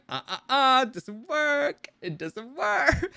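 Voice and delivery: gleeful refusal. Retold or imitated. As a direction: tease him with a slow, high refusal. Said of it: "Gideon's still like".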